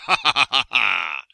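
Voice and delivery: loud booming villain laugh; evil laugh. Acted. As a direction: laugh like a gloating wizard. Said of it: "Gasazi laughing".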